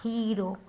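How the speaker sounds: hero with a long ee, softly; soft model of hero as hee-ro. Shown as a demonstration. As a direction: stretch the E in hero. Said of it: "long E".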